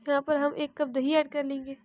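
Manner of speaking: ordinary delivery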